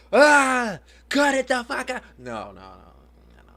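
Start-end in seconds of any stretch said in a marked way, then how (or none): 0.1-2.0 high, loud exaggerated yell; mock yell. Mom showing the harsh yell she doesn't use on her daughter. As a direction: blow up at the kid, hammed-up. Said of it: "where I'm like".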